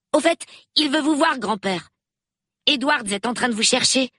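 put-on a nine-year-old thrilled to meet the police; excited little boy. A narrator's reading. Peter gossips about his family. (petit garçon tout excité, voix plus aiguë)

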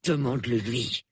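Basil growls at Dina, sullen; growled. A narrator's reading. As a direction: growl it under your breath. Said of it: "grogna Basil".

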